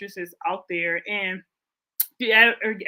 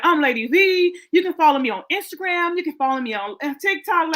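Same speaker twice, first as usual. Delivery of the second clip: higher, louder hammed-up plug; a cheesy influencer shout-out, louder and higher; mock self-promo; 6 semitones higher, 5 dB louder than usual. Joking about plugging her socials, hammed-up louder and higher. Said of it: "I didn't be like".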